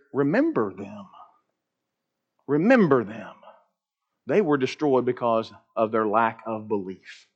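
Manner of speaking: low, soft, grave reading of scripture; solemn quiet quote. Acted. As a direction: let the warning sink in softly. Quoting Jude solemnly.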